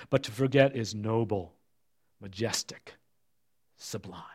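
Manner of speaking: ordinary talk, nothing marked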